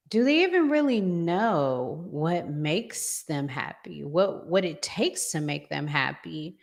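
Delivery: ordinary talk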